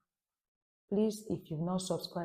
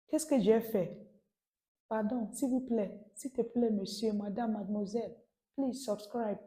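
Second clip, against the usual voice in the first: higher-pitched, put-on begging; mock tearful pleading; 4 semitones higher than usual. French teacher begging viewers to subscribe, mock tearful. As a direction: beg with a pretend cry. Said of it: "why I'm crying".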